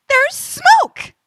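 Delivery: high, loud urgent cry; excited discovery. Acted. Copilot spots smoke, high urgent cry. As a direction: call it out with a jolt.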